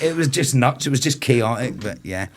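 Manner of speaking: normal talking voice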